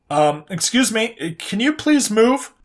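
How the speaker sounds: timid stranger. A narrator's reading. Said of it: "a random voice called out behind them".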